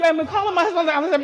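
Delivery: ordinary talk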